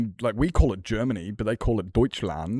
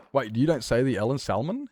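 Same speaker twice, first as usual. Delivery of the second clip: normal talking voice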